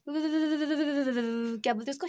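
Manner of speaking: "क्या बोलते उसको" stretched slowly while hunting for the word; slow, groping for the word; slow searching drawl. Real, not acted. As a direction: drag "क्या" out, thinking aloud. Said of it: "repeated while searching word".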